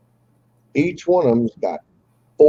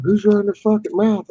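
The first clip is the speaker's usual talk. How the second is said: higher voice for the rival's buddy; mock tough-guy challenge; 11 semitones higher than usual. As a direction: imitate a buddy picking a fight. Quoting the buddies standing up.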